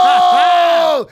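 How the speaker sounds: long, high, loud "oh!"; crowd's big grossed-out whoa; shocked group holler. Retold or imitated. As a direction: holler a drawn-out shocked "oh" at full pitch. Group reacts to brown water.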